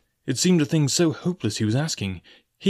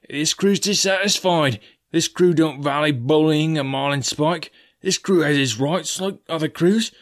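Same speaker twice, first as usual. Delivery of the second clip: a disgruntled crewman, raised and deliberate; surly sailor demanding a council; 5 semitones higher, 4 dB louder than usual. Acted. Narrator voices a grumbling sailor.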